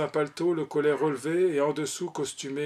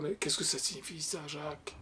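very soft, as if half mad; hushed distraught mother; low, frantic murmur; 8 dB quieter than usual. A narrator's reading. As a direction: say it under your breath, despairing. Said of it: "disant tout bas comme une folle".

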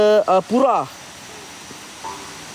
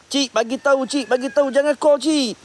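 urgent plea; 8 semitones higher than usual. Real, not acted. Urgent plea during scare.

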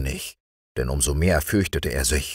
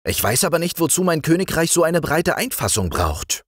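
put-on raised, higher young king; king appointing his friend the frog chamberlain, higher voice; young king's voice; 12 semitones higher than usual. A narrator's reading. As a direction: play the young king granting an honour. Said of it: "sagte der König".

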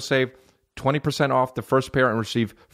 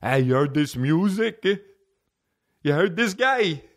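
high, drawn-out goofy caricature of a friend; music buddy impression; 7 semitones higher than usual. Comic imitating music-obsessed buddy to the listeners, goofy high voice. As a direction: exaggerate his eager, higher-pitched voice. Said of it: "That's how he sounds in my head".

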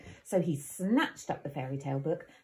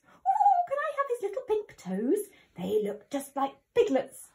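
squeaky little wolf; 14 semitones higher, 4 dB louder than usual. A narrator's reading. Eager little wolf speaking.

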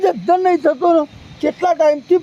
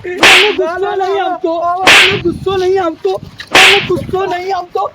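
louder furious denial, over and over; angry shouting; 3 semitones higher, 3 dB louder than usual. Acted. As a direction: hammer the same denial louder each time. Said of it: "આવે દાદા ... ગુસ્સો આવે તમને". (ગુસ્સામાં મોટેથી વારંવાર ઇનકાર)

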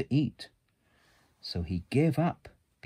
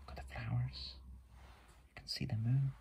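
whisper; 3 semitones higher, 10 dB quieter, much faster than usual. Real, not acted. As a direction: whisper it gently over the book.

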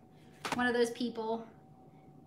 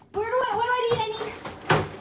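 high, eager rush for the food; bursting with excitement to dig in; way up in pitch, too eager to eat; excited; 10 semitones higher than usual. Real, not acted. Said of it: "A little excited".

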